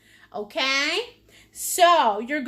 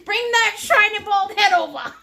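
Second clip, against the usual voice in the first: louder playful call through giggles; laughing tease; 5 semitones higher, 5 dB louder than usual. Real, not acted. Wife teasing her husband about his bald head, laughing. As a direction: tease him, barely holding the laugh.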